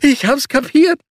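high, loud, panicky plea; pleading Sebastian. A narrator's reading. Sebastian begging the giant to stop, high and panicky. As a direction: beg him to stop.

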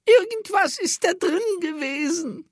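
high, croaking crone voice; croaky old woman. A narrator's reading. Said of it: "krächzte sie".

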